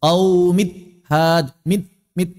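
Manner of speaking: "Qaumit had" with all nasal hum gone; gunnah hilang. Shown as a demonstration. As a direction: cut the end of "Qaumit" short, no nasal ring. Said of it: "hilang gunnahnya seratus persen". ("Qaumit had" tanpa dengung sama sekali)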